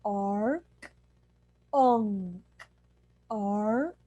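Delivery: demo hollow ark unk. Shown as a demonstration. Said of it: "think of hollowing your throat".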